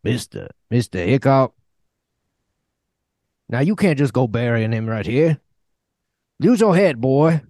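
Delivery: a hint of a gruff frontier sidekick, rising on the last order; Charlie Utter. Podcast host playing Charlie Utter from Deadwood, lightly put-on frontier voice. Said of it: "I've chosen Charlie Utter from the T V show Deadwood".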